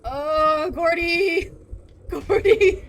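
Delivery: loud, high calling out. Real, not acted. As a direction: shout his name across. Calling out to a friend.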